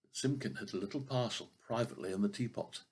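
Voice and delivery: plain reading voice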